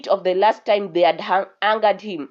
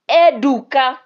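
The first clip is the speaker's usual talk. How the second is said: louder, higher angry call, held longer; angry call again; 5 semitones higher, 8 dB louder than usual. A narrator's reading. Prince calling his guard, angry now. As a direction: call him once more, losing patience. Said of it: "the prince said angrily".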